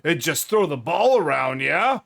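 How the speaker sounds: pumped bro voice; jock. Acted. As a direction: hype them up like a jock. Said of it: "let me put my jock voice on here".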